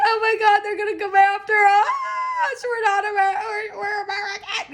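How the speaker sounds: hammed-up high, yelping panic; mock panic. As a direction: squeal like you're about to be caught. Mock panic about being found out.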